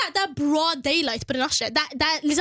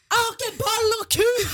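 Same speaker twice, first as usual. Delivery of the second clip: defiant yell; 6 semitones higher than usual. Retold or imitated. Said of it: "står på mitt".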